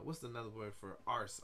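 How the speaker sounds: ordinary delivery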